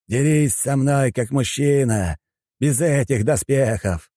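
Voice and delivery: slow, high, drawn-out challenge; taunting challenge. A narrator's reading. Japanese boss daring the armoured admiral, taunting slowly. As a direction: throw down the challenge, stretching each phrase.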